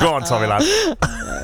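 drawn-out Liverpool Scouse cheer; Scouse accent. Acted. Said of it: "Can you do that in a Scouse accent?".

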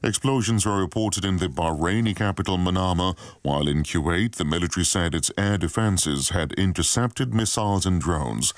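news read. Acted.

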